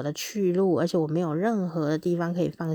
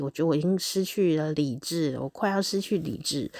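ordinary delivery

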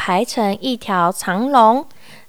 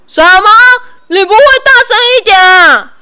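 high, loud, fast bullying yell; fierce roar; 10 semitones higher, 8 dB louder, much faster than usual. A narrator's reading. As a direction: bark at him to speak up. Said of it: "老板凶巴巴地吼".

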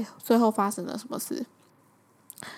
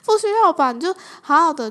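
higher and louder, exasperated dismissal; indignant retort; 6 semitones higher, 6 dB louder than usual. Real, not acted. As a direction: brush off the silly idea with indignation. (愤愤不平地反驳)

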